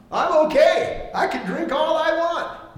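high, slow smug voice; mock careless drinker. Mocking a drinker flaunting it before a struggling brother, high and slow. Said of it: "I'm not gonna sit there and go".

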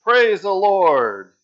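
loud, high, slow joyful cry; old lady hollering praise from her porch; yell. Acted. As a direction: shout it out from the porch. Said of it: "just yell out".

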